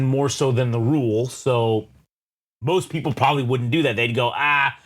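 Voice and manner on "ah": put-on Texan accent. Speaker mimicking people who find a name too ethnic, Texan accent. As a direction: do a hokey Texan. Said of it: "I don't know why I'm doing a Texan accent".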